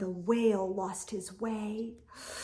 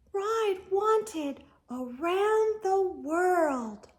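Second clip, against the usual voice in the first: slow announcement; 6 semitones higher, slower than usual. Acted. Snail's trail writes a sign.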